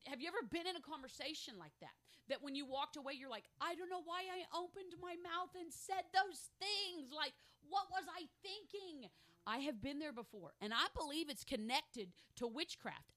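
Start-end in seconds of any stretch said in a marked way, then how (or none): 3.6-9.1 high-pitched mock dismay; baffled self-reproach. Someone walking away from a conversation, high-voiced and baffled. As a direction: act out puzzled regret, pitched up high. Acts out regret after talking.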